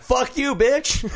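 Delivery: high-pitched mimicked yell at someone; mock furious outburst. Colleague retells star refusing grace, mock furious outburst. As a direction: imitate her screeching back, pitch way up. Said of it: "she's like".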